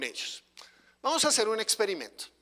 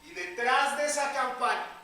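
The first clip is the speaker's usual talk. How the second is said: guided visualization; 6 semitones lower than usual. Acted. Guided imagery with eyes closed.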